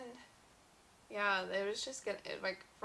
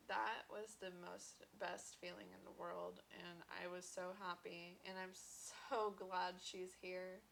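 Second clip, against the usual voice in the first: emotional quiet; 11 dB quieter than usual. Real, not acted. Emotional after watching clip.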